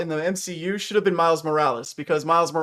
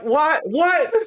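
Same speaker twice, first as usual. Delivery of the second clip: high, loud cry of disbelief; incredulous; 11 semitones higher, 4 dB louder than usual. Retold or imitated. Fan retelling his reaction to a film blunder, incredulous. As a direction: exclaim it twice, pitched way up, baffled.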